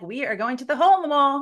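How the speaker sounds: lightly acted proud proclamation; emphatic announcement. Answers girlfriends in acted exchange.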